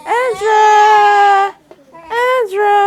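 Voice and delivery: loud high call across the house. Real, not acted. Mother calling her crying baby from another room, loud high call.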